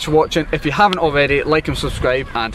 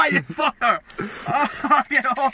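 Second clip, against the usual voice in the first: higher, laughing protest; laughing yelp; 6 semitones higher, 6 dB quieter than usual. Real, not acted. Finger caught in mousetrap.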